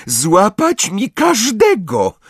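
high, gleeful, triumphant voice; triumphant Umbridge. A narrator's reading. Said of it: "szczerząc zęby w triumfalnym uśmiechu".

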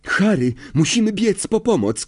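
high, urgent plea to run for help; urgent cry. A narrator's reading. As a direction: Hermione cries out to Harry, urgent and high. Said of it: "krzyknęła Hermiona".

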